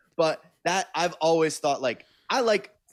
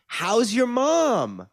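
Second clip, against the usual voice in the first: high slow quote; 8 semitones higher, much slower than usual. Retold or imitated. Woman recognizes him, acted quote.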